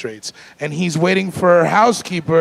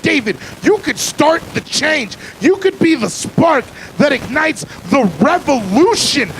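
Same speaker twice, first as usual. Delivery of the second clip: self pep talk; 9 semitones higher than usual. Retold or imitated. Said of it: "And then I was like".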